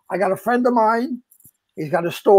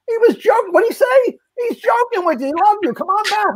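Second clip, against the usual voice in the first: excited; 12 semitones higher than usual. Retold or imitated. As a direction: shout it down the phone, all worked up. Tony retold, excited on phone.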